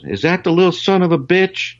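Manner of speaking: high mock teasing voice. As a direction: ham up a rowdy joker's greeting. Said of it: "he goes".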